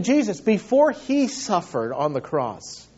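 ordinary talk, nothing marked

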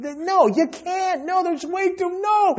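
high, strained no-no-no; rising exasperated refusal; exasperated protest. Real, not acted. Preacher rejecting a view, exasperated.

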